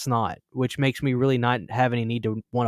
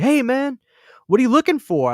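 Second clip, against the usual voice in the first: much higher eager salesman greeting, a touch louder; imitating the vendor; 11 semitones higher, 5 dB louder than usual. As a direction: play the friendly dealer hooking a customer, pitched up. Table vendor greeting a customer.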